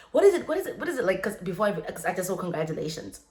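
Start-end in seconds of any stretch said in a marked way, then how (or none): 0.1-1.0 frantic question. Retold or imitated. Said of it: "my sister was like".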